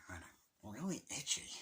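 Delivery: breathy whispered mutter to himself; whispered complaint under the breath; whispered aside. Real, not acted. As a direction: drop to a whisper, just for yourself. Muttered aside about an itch.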